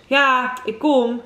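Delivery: child calling back, lightly acted. A narrator's reading. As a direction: answer with a mild storybook shout. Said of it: "riep Roos".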